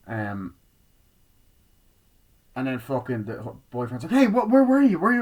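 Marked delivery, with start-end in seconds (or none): 4.1-5.2 high, loud startled yell. Retold or imitated. Boyfriend jumping out at her, loud high yell. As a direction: jump out and shout at her.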